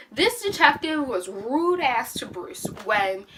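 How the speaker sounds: ordinary talk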